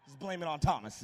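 ordinary talk

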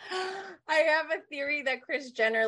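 trying to get it out while still cracking up; breaking into laughter mid-sentence; laughing through it. Real, not acted.

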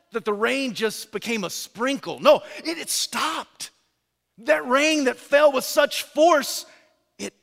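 usual speaking voice

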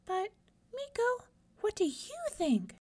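high mouse voice, gently asking; a tiny creature wanting his answer; squeaky mouse asking. Acted. Little mouse asks Meeko what he thinks, high and gentle.

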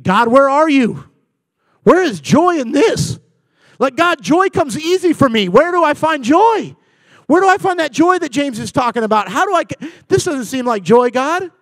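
loud, high, pleading cry; anguished questioning. Retold or imitated. Husband crying to God inside his mind, loud anguish.

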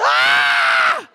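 loud comic scream of pain; hammed-up, full-volume wail; mock scream. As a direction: scream as if just spotting the injury. Notices injured leg, screams.

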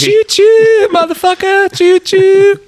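very high squealing train whistle; chugging train with toot. Pretend. Imitating train from movie.

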